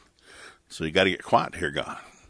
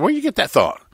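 imitating Mama; 11 semitones higher than usual. Mama asks him.

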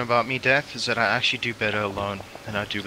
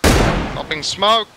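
slow loud warning call; drawn-out loud call to the squad; grenade warning yell; 5 semitones higher, 5 dB louder, much slower than usual. Real, not acted.

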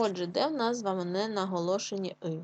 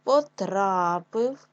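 very slow потрапив, loud long а; syllable-by-syllable потрапив, punched on а; stress on А; 7 dB louder, much slower than usual. Shown as a demonstration. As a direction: stretch out тра in потрапив. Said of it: "наголос на а".

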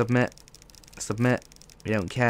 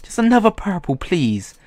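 high, louder begging; pleading whine; 9 semitones higher, 8 dB louder than usual. Real, not acted. Begging the case for a purple, high pleading whine.

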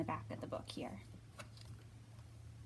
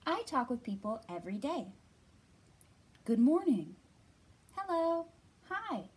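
expressive storybook reading voice; reading to children; 2 semitones higher than usual. Acted. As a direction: read it gently to little kids. Reads picture book to children.

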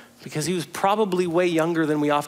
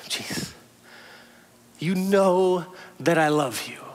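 tender confession; slower than usual. Retold or imitated. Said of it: "Peter says".

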